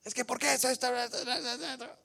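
high, agitated jabber; hammed-up squabbling in a high voice; mock arguing. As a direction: badger away as someone itching to keep fighting. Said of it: "él quiere seguir peleando".

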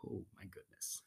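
spent, breathless whisper; a worn-out hushed sigh of words. Acted. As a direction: whisper it as if you barely survived. Exhausted after the silly sounds.

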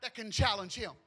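loud, driving preacher climax; full-force preaching. Acted. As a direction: preach it out to the whole room.

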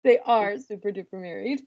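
N=Lane/private; imitating Lane. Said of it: "To quote a great sage, Lane".

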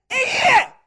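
high loud shout; shouted declaration. Acted. Man of God shouts to the woman. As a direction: burst out with it.

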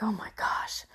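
breathy hushed disbelief; barely voiced oh my gosh; stunned whisper. Real, not acted. Mother reacting to the news, in a stunned whisper. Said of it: "sitting here like".

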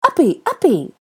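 put-on high, loud little-boy squeal; childish, squealed plea; pleading toddler. A narrator's reading. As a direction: beg excitedly to be picked up. Child begging to be lifted.

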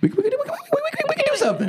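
very high squeaky stammer. Acted.

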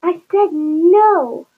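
loud, high, very drawn-out no; angry refusal. A narrator's reading. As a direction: stretch it out as an exasperated parent.

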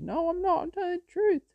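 insistent high falsetto; high lady voice. Retold or imitated. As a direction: protest in a lady's falsetto. Lady answers the police.

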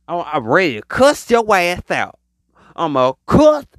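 usual speaking voice

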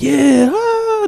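high, drawn-out cheer at normal volume; retold excited scream. Retelling cheering his favorite wrestler's win, mock screaming. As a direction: imitate a kid screaming for a win. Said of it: "I started screaming".

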